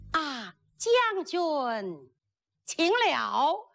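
high, slow stage-lady voice; bride's courtly greeting. Acted. Bride returning the groom's greeting, high slow courtesy. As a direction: draw out a high, formal greeting.

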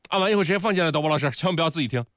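high, fast urging; urgent. Real, not acted. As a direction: hurry the producer along. Urging the producer again.

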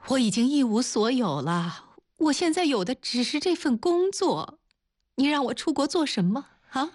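high-pitched, crying distress; tearful protest. A narrator's reading. Luo Jia protests losing the last thing left, tearful. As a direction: voice climbing into tears as you protest.

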